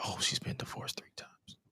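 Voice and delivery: hushed whisper; a secret aside in a whisper. Retold or imitated. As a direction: breathe it out, barely voiced. Private realization while Googling.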